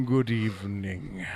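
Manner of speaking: very low, slow, quiet greeting; Hitchcock impression. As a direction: drop deep and drag it out. Imitating Alfred Hitchcock intro.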